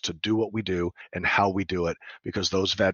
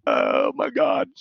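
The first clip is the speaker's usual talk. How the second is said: low, slow, drawn-out "oh my God"; overwhelmed; 9 semitones lower, 4 dB louder, much slower than usual. Retold or imitated. Stunned by the veteran's story, low and slow. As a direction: stretch it out as if stunned. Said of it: "And it was like".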